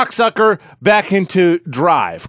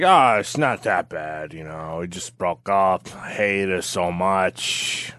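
soft, slow, sulky self-pity; mopey heartbroken voice; 9 semitones lower, 8 dB quieter, slower than usual. Retold or imitated. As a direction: mumble it like a dumped boyfriend. Heartbroken guy after a breakup.

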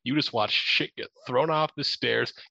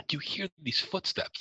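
ordinary talk

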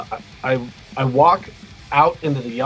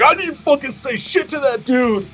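imitated crying protest; 11 semitones higher than usual. Big punk guy crying after getting punched, mock tearful protest. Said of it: "He's crying".

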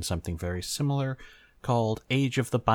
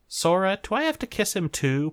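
confused call; 12 semitones higher, 5 dB louder than usual. Acted. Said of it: "sounding genuinely confused".

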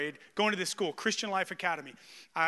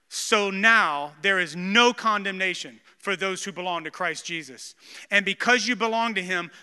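scripture proclamation; 5 dB louder than usual. Acted. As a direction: preach the verse with full force. Reads scripture with force.

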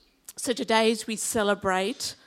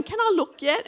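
high, louder mock-anxious question; high pleading question; 10 semitones higher, 5 dB louder than usual. Wife asking husband during a violent film, high mock-anxious voice. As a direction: pipe up like a squeamish moviegoer.

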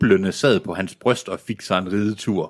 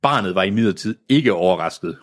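ordinary delivery, nothing marked

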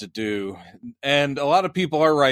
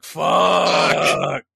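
frustrated curse; 4 semitones higher than usual. Retold or imitated. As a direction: blurt it out in annoyance. Retold curse after hiccup.

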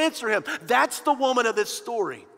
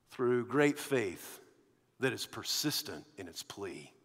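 solemn sermon point; 11 semitones lower, 7 dB quieter than usual. Acted.

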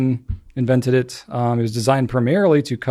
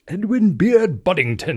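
mock-posh British accent, higher and slow; 6 semitones higher, slower than usual. Shown as a demonstration. Host saying the name on co-host's request, British accent. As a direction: put on a proper British accent, drawn out and lilting. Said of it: "Say it with a British accent".

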